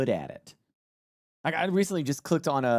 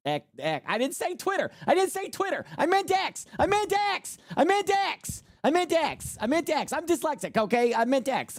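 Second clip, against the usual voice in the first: much higher, hammed-up insistent repeats; frantic mock correction; 13 semitones higher than usual. Correcting himself on Twitter versus X in mock panic, much higher. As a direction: blurt the correction over and over in a panic.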